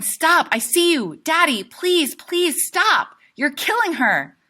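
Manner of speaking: high, loud pleading scream at his father; desperate scream. Acted. Boy screaming at his father to stop choking her. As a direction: scream it, begging him to stop. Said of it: "I'm going".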